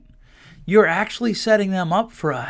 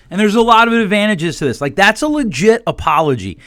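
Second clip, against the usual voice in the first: ordinary talk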